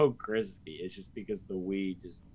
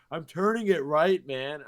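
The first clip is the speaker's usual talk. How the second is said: loud, high-pitched exasperated protest; mock a raging player's whiny outburst; imitated gamer rage; 7 semitones higher, 10 dB louder than usual. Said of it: "they would just start raging".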